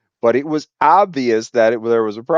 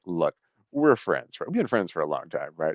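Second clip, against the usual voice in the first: defeated plea; 7 semitones lower, 8 dB quieter than usual. Retold or imitated. Coworker coming down the stairs beaten, pleading low and soft. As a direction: plead quietly like you've lost. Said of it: "she had this defeated look on her face".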